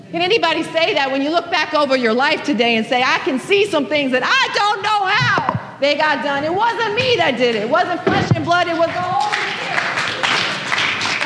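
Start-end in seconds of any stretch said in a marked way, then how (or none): 3.0-11.3 testimony cry. Retold or imitated. Preacher voicing a believer's testimony.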